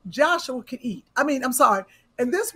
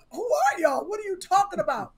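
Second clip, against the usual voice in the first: confused; 8 semitones higher than usual. Retold or imitated. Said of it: "the daughter in the middle was like".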